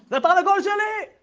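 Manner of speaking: high, loud shout claiming the rooster; angry shout. Acted. Shmerl shouting to Zelig that the rooster is his, loud angry cry. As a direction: yell it like it's yours.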